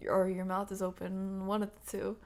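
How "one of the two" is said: ordinary delivery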